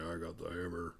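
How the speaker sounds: low, soft mimicked voice; understated low character quote; imitated boss. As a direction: drop low and quiet as the boss. Retold boss turned zombie grabs hammer.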